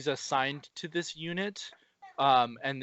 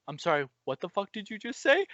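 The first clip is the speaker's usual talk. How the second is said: higher, in put-on outrage; mock disbelief; 6 semitones higher than usual. Reacting to the scientist telling them to open the gates, mock disbelief. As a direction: act floored by what you just heard. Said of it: "And I'm like".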